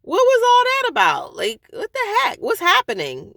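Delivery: high, loud exasperated cry at her husband; exasperated outburst. Retold or imitated. Venting to camera about her husband, high exasperated yell. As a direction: let the frustration burst out, way up high. But now I'm getting pissed.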